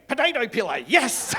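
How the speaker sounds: loud, high shout of triumph; triumphant shout. Retold or imitated. Calling out the stolen loot to his mate, triumphant shout. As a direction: yell it like a winner.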